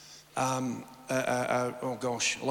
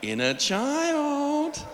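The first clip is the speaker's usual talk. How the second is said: much higher and very slow, teasing; mocking sulk voice; 11 semitones higher, much slower than usual. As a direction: drag it out to mock a sulker. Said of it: "you're going".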